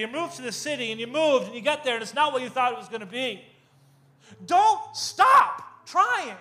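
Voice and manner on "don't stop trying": loud high drawn-out preacher plea; impassioned plea. Real, not acted. Urging the congregation not to quit, loud impassioned plea. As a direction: preach it with full force, slowly. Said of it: "climactic preaching plea".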